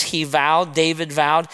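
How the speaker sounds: ordinary talk, nothing marked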